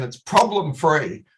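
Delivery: "problem-free" drawn out slowly and stressed; slow down and land on "problem-free"; emphasize problem-free. Shown as a demonstration. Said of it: "I want to emphasize that word problem-free".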